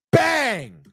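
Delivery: high, explosive exclamation; excited goal call. Real, not acted. Calling out the goal.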